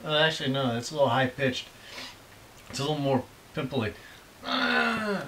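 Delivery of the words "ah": lightly voiced monster groan, higher; a hint of a lumbering monster; Frankenstein groan. Dad testing his impression, mild monster groan. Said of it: "that's more like Frankenstein".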